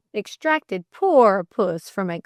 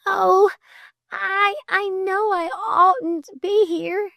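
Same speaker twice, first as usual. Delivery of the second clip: high, halting, near-crying confession; high-pitched faltering distress; tearful struggle; 11 semitones higher, slower than usual. A narrator's reading. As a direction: struggle to speak through welling tears. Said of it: "she struggled".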